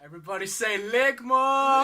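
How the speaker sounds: shouted high and loud to the room; rally everyone to yell it; group call-out. Real, not acted. Leading friends in a meme chant, big shout.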